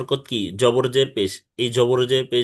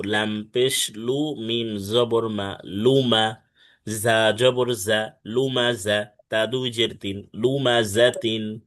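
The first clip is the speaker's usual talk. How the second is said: slow model spelling; slower than usual. Shown as a demonstration. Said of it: "Luma জা তিন না তো".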